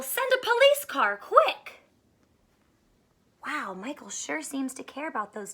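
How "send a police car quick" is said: higher urgent call; mild urgent yell. A narrator's reading. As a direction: urge them to hurry. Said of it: "yelled Michael".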